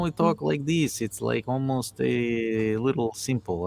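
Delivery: slow into a put-on Albanian accent. Shown as a demonstration. Host showing co-host how Albanians talk, put-on Albanian accent. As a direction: demonstrate an Eastern Bloc Albanian accent. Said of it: "Albanian and Polish accents are almost the same".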